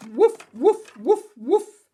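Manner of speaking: loud, high woofs; dog barking. Acted. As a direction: bark urgently like a dog.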